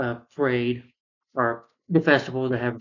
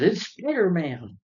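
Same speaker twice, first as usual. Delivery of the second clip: high, drawn-out cheer; excited onlooker; 6 semitones higher, much slower than usual. Retold or imitated. Somebody spotting Spider-Man at the parade, excited cry. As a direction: imitate a crowd member calling out. Onlooker cries out at seeing hero.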